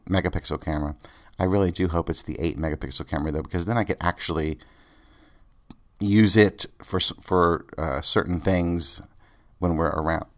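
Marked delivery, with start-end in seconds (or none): 6.0-7.7 burp breaking into the words. Real, not acted. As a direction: talk through a burp. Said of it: "Excuse me, I'm burping".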